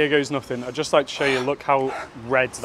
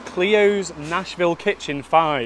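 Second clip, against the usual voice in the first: slow, every word laid out; slow spelled-out code; 2 semitones higher, slower than usual. Shown as a demonstration. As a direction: read the code out slowly. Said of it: "like this".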